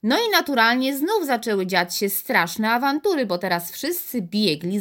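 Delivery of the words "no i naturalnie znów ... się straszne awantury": usual speaking voice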